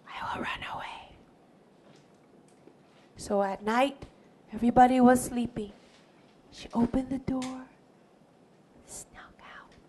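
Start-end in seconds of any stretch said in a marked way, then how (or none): 0.1-1.2 very soft, hurried whisper; secretive whisper. Acted. Scared girl plans to run away, whispering. 6.5-9.7 barely audible storyteller's whisper; sneaky whisper. A narrator's reading. Narrator follows girl sneaking out, whispering.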